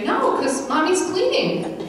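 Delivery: put-on high, slow innocent child; little boy voice. Son telling Grammy about the cleaning, high innocent child voice. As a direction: say it like a child who doesn't know he's blabbing. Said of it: "I hear him say".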